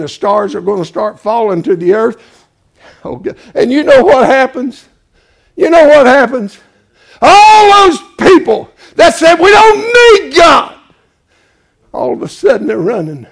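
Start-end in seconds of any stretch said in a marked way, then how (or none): 3.5-9.4 louder and higher each phrase; climbing revival preaching; building preacher shout. Acted. 9.4-10.7 loud, high, slow shout of the unbelievers; hammed-up defiant sinners; mocking scoffers.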